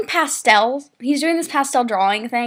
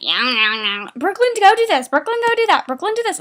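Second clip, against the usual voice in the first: mocking, high-pitched nagging orders; singsong mock nagging, mostly high; nagging teacher; 5 semitones higher than usual. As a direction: imitate someone endlessly bossing you around. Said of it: "She's always just like".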